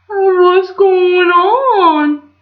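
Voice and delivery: high, loud, very slow dopey dwarf. Acted. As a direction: stretch it out dim and puzzled. Dopey's line, named after.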